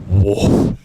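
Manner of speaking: a loud, startled whoa at the smell. Retold or imitated. Opening the dorm door onto a dead smell, loud startled whoa. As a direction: recoil from the stench out loud. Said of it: "I said".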